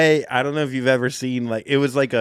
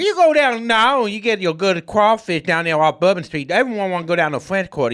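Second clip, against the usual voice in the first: hammed-up New Orleans accent; 6 semitones higher than usual. Cohost doing a sound effect for New Orleans, in a hammed-up New Orleans accent. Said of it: "do your whole set in that accent".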